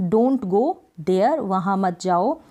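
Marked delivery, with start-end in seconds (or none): 0.1-1.4 very slow, each word set apart; slow dictation. Shown as a demonstration. Teacher dictating an English sentence to learners, very slow. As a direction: spell it out slowly for students. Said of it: "don't go वहाँ यानी कि there".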